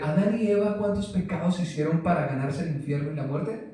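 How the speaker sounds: normal talking voice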